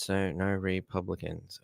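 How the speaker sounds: a hushed joke under the breath; whispered aside. Real, not acted. Host whispers a joke about a viewer's name, hushed.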